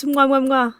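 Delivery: goodnight kiss. Pretend. Good night kiss to Jay.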